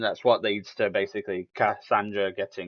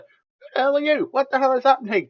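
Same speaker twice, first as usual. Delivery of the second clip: high-pitched, loud panicked squeal; mock screaming; 6 dB louder than usual. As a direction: imitate screaming bystanders, pitched way up. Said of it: "other one's just screaming".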